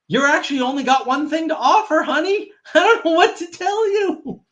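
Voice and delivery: high, laughing put-down of women; high giggling mockery. Real, not acted. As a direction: laugh it out, mocking.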